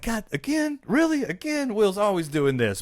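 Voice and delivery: high-pitched mock exasperation; exasperated imitation. Carlton fed up as Will steals the girl, high whine. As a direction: complain in disbelief, pitch way up. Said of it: "he's just like".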